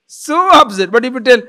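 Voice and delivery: usual speaking voice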